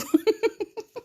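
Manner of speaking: contagious giggle; high laugh. Real, not acted. Host sharing contagious laughter with viewers, high giggle. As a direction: laugh freely. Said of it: "Le rire est contagieux".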